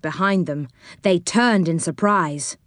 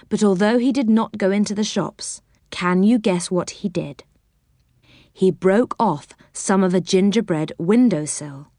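ordinary delivery, nothing marked

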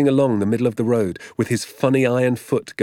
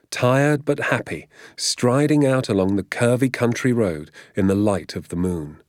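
plain reading voice

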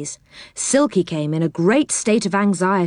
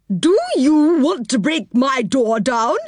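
put-on slow, higher, indignant old woman; cross old woman crying out; 6 semitones higher than usual. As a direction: scold them slowly from the doorway. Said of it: "she cried".

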